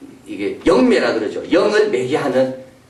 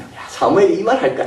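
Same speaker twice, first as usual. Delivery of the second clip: ordinary talk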